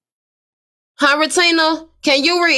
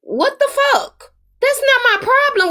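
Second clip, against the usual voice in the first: can't believe what she's hearing; incredulous; 10 semitones higher than usual. Real, not acted. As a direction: pitch jumps way up in disbelief. Disbelief in heated argument.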